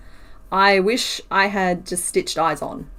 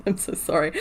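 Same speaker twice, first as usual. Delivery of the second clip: ordinary talk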